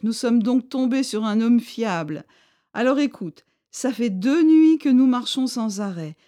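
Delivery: a tired leader giving orders politely; chief's voice. A narrator's reading.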